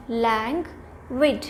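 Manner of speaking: languid slowly split as "lang... wid"; teacherly languid with a clear gap after "lang"; syllable by syllable. Shown as a demonstration. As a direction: break languid into two separate beats. Teacher breaks up new word.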